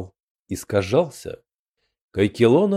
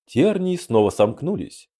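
plain reading voice

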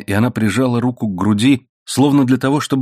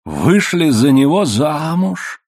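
slow, higher-pitched incredulous echo; horrified astonishment; 6 semitones higher, slower than usual. A narrator's reading. Man learns she married the renegade, appalled. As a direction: stretch the question out in shock. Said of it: "Ужас сменил его удивление".

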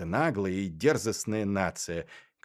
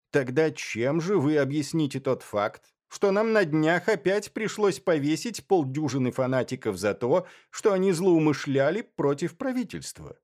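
higher, louder voice for a demanding questioner; challenging interrogator; 6 semitones higher, 4 dB louder than usual. A narrator's reading. Bennet confronts his guest about the hanged fanatics, challenging. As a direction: press the question forcefully, pitched up.

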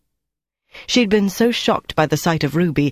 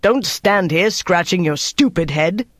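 slow, scolding raised voice; 2 semitones higher than usual. A narrator's reading. Officer scolding the idle sergeant, raised voice. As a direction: berate him slowly, mildly raised voice. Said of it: "Dieter raised his voice".

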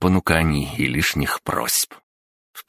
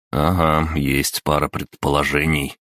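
ordinary delivery

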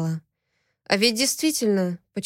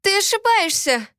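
frightened protest; 12 semitones higher than usual. A narrator's reading. Frightened protest while choked.

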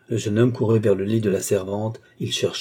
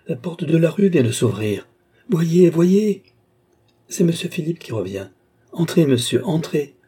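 high, raised voice of a thrilled servant; excited announcement; 8 semitones higher, 4 dB louder than usual. A narrator's reading. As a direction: call out the arrival, peaking loud. Servant excitedly announces the master's return. (annonce excitée, voix haute et forte)

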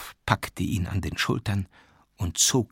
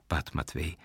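plain reading voice

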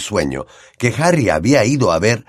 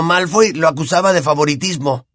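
put-on higher boyish laugh then the name Malfoy; 8 semitones higher than usual. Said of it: "Ron se rió estruendosamente".

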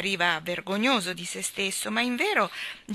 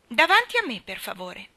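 high, loud opening, then dropping; haughty order; 5 semitones higher, 6 dB louder than usual. A narrator's reading. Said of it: "ordinò con alterigia Nelly Rubina". (ordine dato con alterigia)

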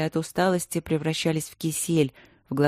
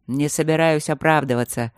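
plain reading voice

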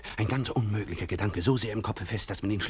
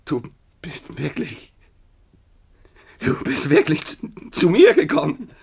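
overjoyed disbelief; 8 semitones higher, 8 dB louder, slower than usual. Acted. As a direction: voice leaps high and loud. Alexei overwhelmed by disbelief.

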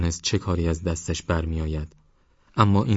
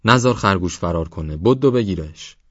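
taunting the chasing father; mocking shout; 5 dB louder than usual. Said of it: "داد زد".

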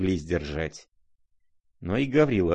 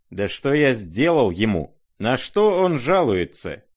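raised, higher-pitched protest; indignant cry; 5 semitones higher, 5 dB louder than usual. A narrator's reading. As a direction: cry out, stung by the accusation. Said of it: "вскричал Ипполит, скаля зубы".

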